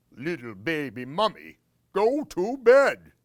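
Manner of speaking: loud, high boom on go to bed; booming monster. Acted. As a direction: swell up big on the last words. Glob scolds baby mummy.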